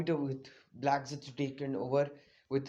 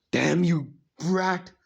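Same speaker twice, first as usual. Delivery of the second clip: loud villain's outburst; Madara's angry curse; 6 dB louder, much slower than usual. Acted. Madara hit by Naruto's blast, loud angry curse. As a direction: curse the kid with mock fury.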